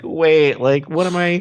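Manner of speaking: high, alarmed self-question; panicked inner voice. Retold or imitated. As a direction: voice jumps up in sudden panic. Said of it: "like, thing of, like".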